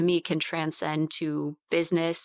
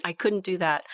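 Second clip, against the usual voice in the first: normal talking voice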